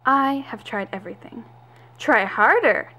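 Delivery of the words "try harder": a sharp, loud command; angry demand. Acted. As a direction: push her hard, fed up. Boss pressures her scientist.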